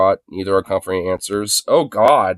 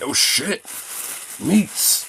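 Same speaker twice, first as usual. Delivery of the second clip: high mock-excited "oh shit, meats"; imitating excited dog; 7 semitones higher, 6 dB quieter, much slower than usual. Owner voicing the dog's meat frenzy, mock excited. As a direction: voice the dog losing it over meat. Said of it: "be like".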